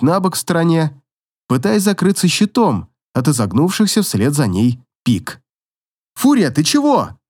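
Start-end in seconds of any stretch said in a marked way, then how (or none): 6.1-7.2 higher, shouted worried call. Acted. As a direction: call out to her in alarm. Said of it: "крикнул Гвиндон".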